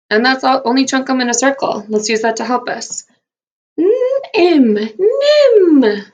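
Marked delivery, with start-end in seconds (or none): 3.8-4.7 put-on high, goofy kids' teacher voice; silliest voice. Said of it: "let's do our silliest voices together". 4.7-6.0 very high, goofy drawn-out voice; silliest voice. Pretend. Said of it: "let's do our silliest voices together".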